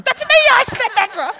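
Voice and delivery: high, loud, laughing squeal of recognition; imitating the lady. Speaker imitates lunch lady recognising her own quote, high laughing squeal. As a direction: squeal it like a delighted lunch guest. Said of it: "I can hear her going".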